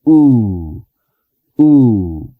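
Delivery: U drawn out long with rounded lips; long English u vowel. Shown as a demonstration. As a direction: hold the U longer. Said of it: "U, pero un poco más larga".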